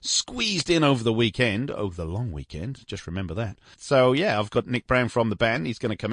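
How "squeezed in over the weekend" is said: normal talking voice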